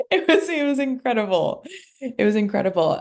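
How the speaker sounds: high, loud laugh through the words; laughing, moved. Real, not acted. Coach recalling the couple's breakthrough, laughing and moved. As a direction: laugh it out, overwhelmed.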